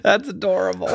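words squeezed through giggles; laughing. Real, not acted. Amused by the childhood story, laughing. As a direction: say it while still cracking up.

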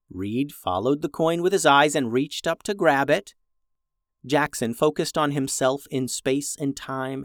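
plain reading voice

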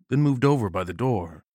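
ordinary delivery

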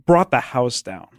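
usual speaking voice

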